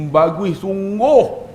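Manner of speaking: very slow, stretched-out mock admiration; lingering, sing-song praise; admiring praise. Shown as a demonstration. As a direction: draw out each word like a doting mother. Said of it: "nada dia tu lain".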